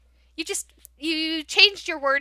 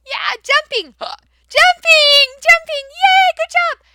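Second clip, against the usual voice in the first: much higher, squealing, overjoyed; delighted praise; 12 semitones higher, 8 dB louder, slower than usual. Shown as a demonstration. Teacher celebrating the class's right answer, delighted. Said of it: "you can help him".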